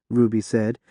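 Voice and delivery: usual speaking voice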